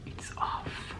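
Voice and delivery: soft whispered aside; whispering. Real, not acted. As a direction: whisper it to yourself. Whispered aside while cleaning mascara.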